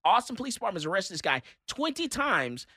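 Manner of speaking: ordinary talk, nothing marked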